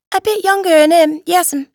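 high-pitched little East End lad; young boy, deferential. A narrator's reading. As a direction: answer up high like a dutiful boy.

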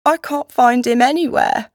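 put-on despairing boy. A narrator's reading. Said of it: "dull tone of one driven almost past tears to despair".

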